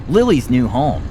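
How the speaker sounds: ordinary delivery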